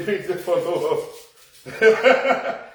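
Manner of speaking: laughing through the words; chuckling mid-brag. Real, not acted. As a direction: let the laugh break through. Laughing at his own joke.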